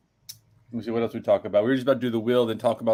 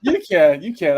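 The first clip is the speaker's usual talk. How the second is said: louder, higher insisting; insistent loud; 5 semitones higher, 9 dB louder than usual. Real, not acted. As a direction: press the point hard. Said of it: "I'm not sure you can".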